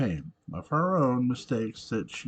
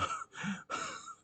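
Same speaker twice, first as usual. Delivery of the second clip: short breathy chuckle to oneself; quiet laugh; 10 dB quieter than usual. Real, not acted. Reader breaking off from the story, quiet laugh. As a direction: break off in a soft unvoiced laugh.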